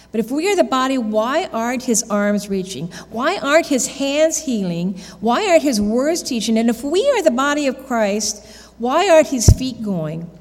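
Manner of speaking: fervent outcry, voice lifted and loud; impassioned build. Acted. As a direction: preach it out, rising with each why. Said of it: "it goes like this".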